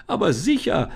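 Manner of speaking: put-on keen girl calling out; eager call. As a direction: shout it out gladly as the girl. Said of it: "rief Lisa".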